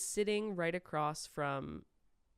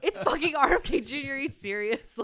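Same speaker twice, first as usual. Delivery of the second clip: loud, high, incredulous outburst; exasperated yell; 9 semitones higher, 10 dB louder than usual. Retold or imitated. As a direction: yell it in disbelief. Retold exasperated reply to friend.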